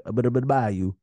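light mock Louisiana accent. Friend imitates Louisiana people, light mock Louisiana accent. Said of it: "Louisiana people just be like".

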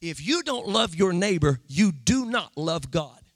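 ordinary delivery

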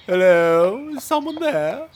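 high, loud call into the dark; posh servant calling out. Acted. Servant hearing robbers on the road calls out nervously.

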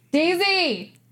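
high, drawn-out name call. Real, not acted. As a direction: call someone in from another room. Calling someone in from elsewhere.

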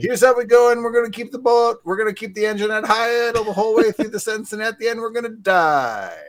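very high, loud sportscaster voice held up with no dips; mock stuck-high intonation. Mocking the analyst's delivery, loud and high-pitched throughout. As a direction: keep the pitch pinned high to the end of the sentence. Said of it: "he goes up and then he stays up".

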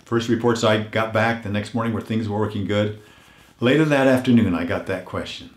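ordinary talk, nothing marked

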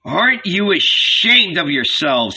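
understated shout of rebuke; shouted reproach. A narrator's reading. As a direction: scold the whole crowd. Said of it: "he shouted at them all".

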